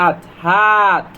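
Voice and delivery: stretched, slow sound-out; slow phonics sound-out; slow blending. Shown as a demonstration. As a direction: blend each sound slowly for children. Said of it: "slow phonics blending demo".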